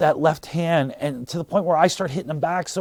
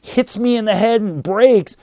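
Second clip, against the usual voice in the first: ordinary talk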